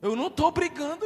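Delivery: mock loud protest. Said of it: "eu digo".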